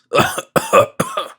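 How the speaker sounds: harsh racking cough fit; violent cough. A narrator's reading. Old miser coughing violently on his plank. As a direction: hack it out, convulsed. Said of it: "the cough was so violent".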